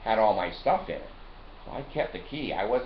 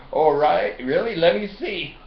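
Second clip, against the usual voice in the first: higher, louder imitation of an eager helper; mock surprise; 7 semitones higher, 7 dB louder than usual. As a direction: retell his surprised reply, pitched up and louder. Acting out next employee's reaction to problem.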